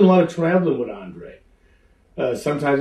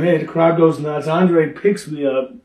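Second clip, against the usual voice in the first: usual speaking voice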